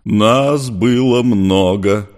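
slow, loud rumble; rumbling. Acted. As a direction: roll the words out heavily. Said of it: "пророкотал Чёрный".

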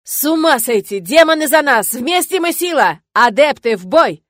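loud, high rallying shout, slowing at the end; booming battle cry. A narrator's reading. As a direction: roar it out to rally the fighters. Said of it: "неожиданно зычно закричал". (зычный боевой клич)

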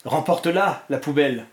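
plain reading voice

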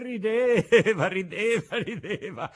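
laughing through the words. A narrator's reading. Narrator laughing while telling the crowd laughed. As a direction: let the laugh spill into rideva.

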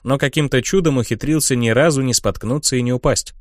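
plain reading voice

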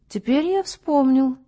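slow, vacant recollection; slow, like a dummy. A narrator's reading. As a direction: drag it out like a wooden doll. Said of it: "медленно, как болванчик". (медленно, как болванчик)

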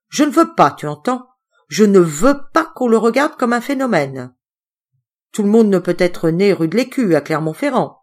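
loud, high-pitched telling off; angry scolding. Acted. Uncle keeps scolding.

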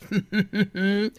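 high sneering chuckle; mocking laugh. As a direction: laugh at him. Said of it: "a nevetéstől". (gúnyos nevetés)

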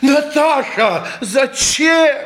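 high, drawn-out anguished reproach; dismayed cry. Acted. Man reproaching Natasha for revealing the secret, dismayed high cry.

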